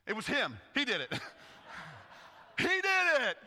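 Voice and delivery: high, loud tattletale; blaming. Acted. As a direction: point the finger in a panic. Silas blaming Paul, laughing.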